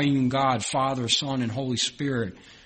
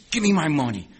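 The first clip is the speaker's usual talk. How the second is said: demanding; 3 semitones higher than usual. Acted. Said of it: "grabs him by the neck and says".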